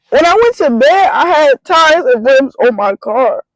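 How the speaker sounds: high and slowed, each word pressed in disbelief. Retold or imitated. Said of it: "in disbelief".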